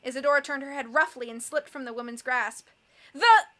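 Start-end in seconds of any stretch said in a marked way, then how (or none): 3.1-3.5 loud, high word broken off; abrupt raised fragment; cut-off cry. A narrator's reading. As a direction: start shouting and get silenced. Captive's cry gets cut off.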